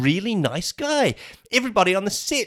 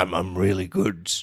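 lower, slow deep villain voice; Thanos; 10 semitones lower than usual. Acted. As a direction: put on a big low Mad Titan voice. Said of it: "interview with Robert Downey Junior and Thanos".

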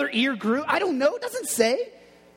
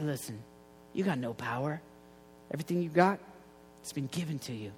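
calm Jesus; 5 semitones lower, 9 dB quieter, faster than usual. Retold or imitated. Jesus calmly answers Pilate.

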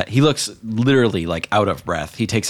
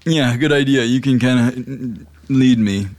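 put-on high, loud drunken urging; eager tipsy push to go; 6 semitones higher, 5 dB louder than usual. Drunk Dave.

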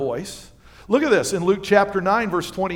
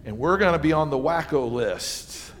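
ordinary delivery

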